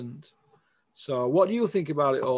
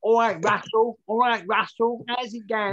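a higher-pitched, lightly put-on trainer greeting; Mark Tibbs voice; 7 semitones higher, 3 dB louder than usual. Host imitates a boxing trainer greeting Russell, lightly put-on and higher. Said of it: "Sound like Mark".

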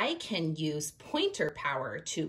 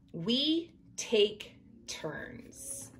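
slow, word-by-word reading with a pause after each; slow pointing read-aloud; 4 semitones higher, 3 dB quieter, much slower than usual. Acted. Teacher modelling finger-point reading for young readers, slow and even. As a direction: read it slowly, one word per point.

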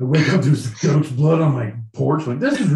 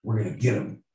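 ordinary talk

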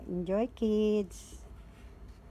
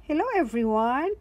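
cheerful cry; 5 semitones higher, 4 dB louder, faster than usual. A narrator's reading. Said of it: "cries Peppa".

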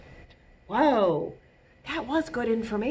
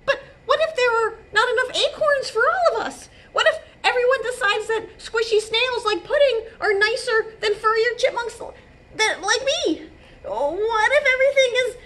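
high, fast fretting, building louder; worried thinking aloud; 4 dB louder than usual. A narrator's reading. Jitterbug fretting about sharing the forest, anxious. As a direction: spiral into squeaky chipmunk panic. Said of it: "thinking aloud".

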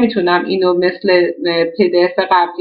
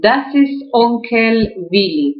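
slow reading; much slower than usual. Shown as a demonstration. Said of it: "Arts by Eins".

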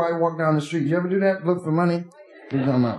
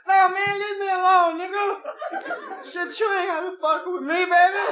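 put-on much higher, whiny drunk plea; whining drunk; 11 semitones higher than usual. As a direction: whine like a sloppy drunk. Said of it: "He starts".

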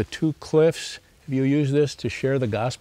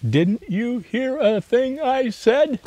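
high, slow, pleading exasperation; reading Addie's furious cry to the therapist, high and slow; exasperated outburst; 11 semitones higher, much slower than usual. Retold or imitated. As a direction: stretch it out in disbelief, pitched way up. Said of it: "Addie was furious".

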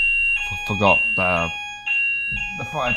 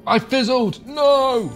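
dismayed cry; 11 semitones higher, 4 dB louder, much slower than usual. Real, not acted. Spell fails.

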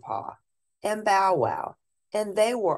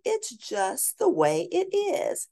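much higher and slow, matter-of-fact; high child voice. Acted. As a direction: patiently explain like a little girl. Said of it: "said Lady".

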